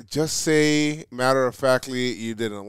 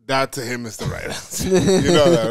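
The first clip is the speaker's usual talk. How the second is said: ordinary talk, nothing marked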